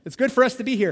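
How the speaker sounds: high and loud, gushing; mock eager Peter. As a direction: gush like an overexcited fan. Preacher mimics Peter again.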